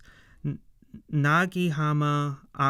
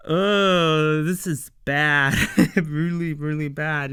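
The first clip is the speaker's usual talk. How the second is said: laughing at the bad news; 4 dB louder than usual. Real, not acted.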